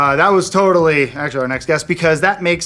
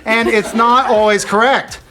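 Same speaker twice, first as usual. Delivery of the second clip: JFK impression. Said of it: "Now it's J F K".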